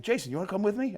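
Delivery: high, lightly voiced invitation. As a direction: pitch it up like a cheerful old man. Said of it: "He's like".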